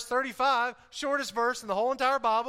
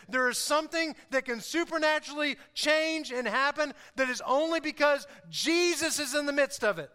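raised, emphatic preaching voice; 6 semitones higher, 5 dB louder than usual. Acted. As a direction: drive each point home to the congregation. Preaches with force, later talks plainly.

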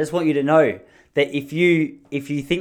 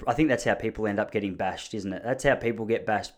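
ordinary delivery

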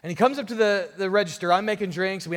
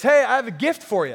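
louder, higher mock-friendly hey; loud sarcastic jab; 5 semitones higher, 6 dB louder than usual. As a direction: call it out sarcastically for the whole store. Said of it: "he comes back really loudly, really sarcastically".

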